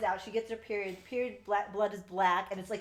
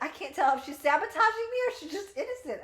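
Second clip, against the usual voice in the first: mock Gideon; 10 semitones higher, 7 dB louder than usual. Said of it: "He's like".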